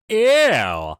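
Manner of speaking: loud, high disgusted yelp. Real, not acted. Reacting to a gross suggestion.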